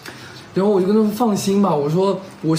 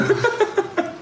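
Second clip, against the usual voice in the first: shrieking laugh. Real, not acted. Cohost's joke about oral.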